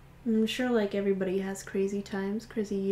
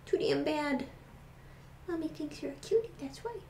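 higher, softer fond answer; mother teasing her bunny fondly; doting reply; 6 semitones higher, 6 dB quieter than usual. Real, not acted. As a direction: tease him tenderly. Mom answering for the rabbit.

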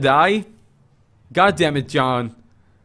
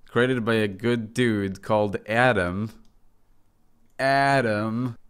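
usual speaking voice

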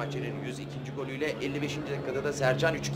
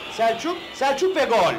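excited goal call; 6 semitones higher, 6 dB louder than usual. Acted.

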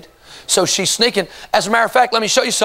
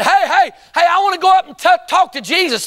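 eager calling out; 9 semitones higher than usual. Acted. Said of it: "if she would have done like, uh, Jairus and said".

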